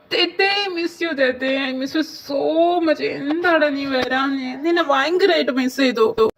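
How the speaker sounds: high, loud, drawn-out affection; gushing greeting. Acted. Friend greeting a missed friend, gushing. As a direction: stretch the words out, overflowing with fondness.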